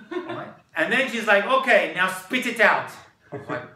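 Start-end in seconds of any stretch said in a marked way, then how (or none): 1.5-2.9 put-on loud Israeli-accented order; emphatic command in an Israeli accent. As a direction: imitate a bossy Israeli guide. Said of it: "Here's my Israeli accent".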